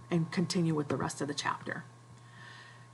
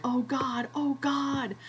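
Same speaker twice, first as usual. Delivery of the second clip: higher, mock despairing groan; dismay; 8 semitones higher, slower than usual. Ralph finding the fire out, higher mock despair. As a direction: Ralph seeing the dead fire, lightly acted. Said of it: "And he's like".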